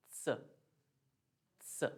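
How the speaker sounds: स said as "tsa", half T fused with S; tongue taps up before each स; German Z sound. Shown as a demonstration. Teacher showing how Z converts in words, ts sound. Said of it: "इसका आधा त और".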